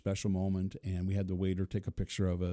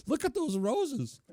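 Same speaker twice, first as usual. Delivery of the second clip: coaxing; slower than usual. Retold or imitated. Urging her to look.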